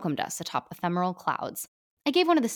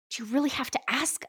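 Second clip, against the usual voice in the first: hissed; 6 semitones higher than usual. Acted. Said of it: "I hissed".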